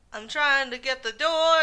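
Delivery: lightly hammed-up, stretched-out pleading line; mimicked song character. Host mimics the woman in the duet, mock dramatic.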